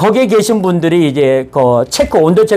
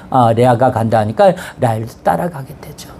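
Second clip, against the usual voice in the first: ordinary delivery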